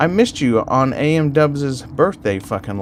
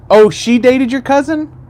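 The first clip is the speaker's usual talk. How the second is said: surprised; 10 semitones higher, 4 dB louder than usual. Real, not acted. Reacting to a chat message.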